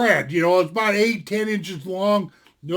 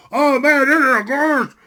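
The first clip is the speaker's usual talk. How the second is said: talking through a mouthful; words round a big bite; mouth full; 3 semitones higher, 4 dB louder than usual. Retold or imitated. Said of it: "I got my mouth full".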